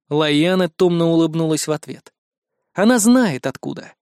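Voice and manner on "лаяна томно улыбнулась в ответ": ordinary delivery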